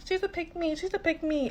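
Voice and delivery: high sneering taunt of the accusers; mocking accusers. Mimicking critics who label her, mocking and high. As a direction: throw the label back in a mocking singsong. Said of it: "being called a pick-me".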